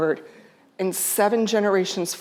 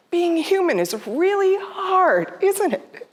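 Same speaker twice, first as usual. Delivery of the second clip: higher, slow and gentle; tender; 9 semitones higher than usual. Retold or imitated. Daughter comforting dying mother, gently tender.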